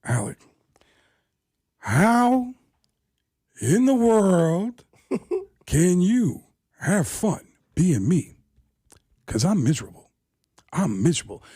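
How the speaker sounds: slow, drawn-out anguished Pacino; Al Pacino impression. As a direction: stretch out how in the world like a lament. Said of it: "Pacino looked at him and said".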